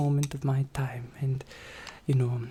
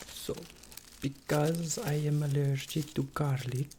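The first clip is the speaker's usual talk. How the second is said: ordinary talk, nothing marked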